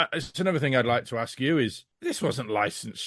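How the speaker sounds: usual speaking voice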